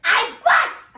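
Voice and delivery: angry threat. Acted. Husband answering wife's doubt, angry threat. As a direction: insist on the threat.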